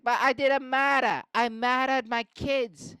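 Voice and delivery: high, hammed-up Boston distress; upset Boston confession. Mark confessing to police about his kids, upset Boston accent. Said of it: "he got really upset".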